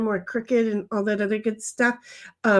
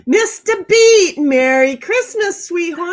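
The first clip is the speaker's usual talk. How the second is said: calling out greeting; 5 semitones higher, 7 dB louder than usual. Real, not acted.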